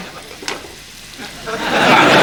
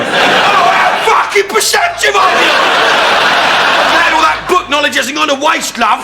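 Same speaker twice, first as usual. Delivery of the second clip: sarcastic outburst; 9 semitones higher than usual. Real, not acted. [name] mocking the psychiatrist, high sarcastic shout. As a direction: bite back at the therapist with shouted scorn.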